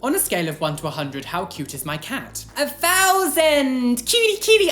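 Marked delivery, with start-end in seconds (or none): 2.6-4.7 high squeal of adoration; slow then squealing cutie, cutie; gushing. Real, not acted. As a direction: coo at the cat in delight. Gushing over cat cuteness.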